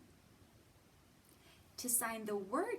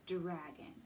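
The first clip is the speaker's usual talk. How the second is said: normal talking voice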